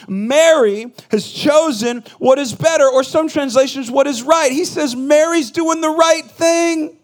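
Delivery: loud, high, slow sermon voice; fervent preaching. Acted. Pastor preaching to the congregation, full force. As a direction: preach it out to the church slowly.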